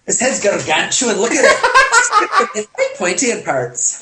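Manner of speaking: lightly put-on Scottish mockery, rising high; Scottish movie quote. Guest keeps quoting the film, laughing light Scottish voice. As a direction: tease through a laugh like the film's Scot. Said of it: "that's all I could think of".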